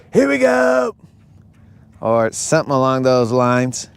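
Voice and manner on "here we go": higher shouted Here we go; quarterback cadence shout. Retold or imitated. Imitating a quarterback's cadence at the line, high shout. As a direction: call the snap count like a quarterback. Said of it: "cadence is".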